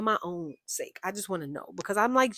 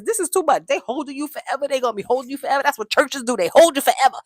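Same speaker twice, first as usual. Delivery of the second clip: high, loud hammed-up complaining; mock exasperated rant; 6 semitones higher, 8 dB louder than usual. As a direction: rant like a fed-up churchgoer. Acts out fed-up churchgoer's rant.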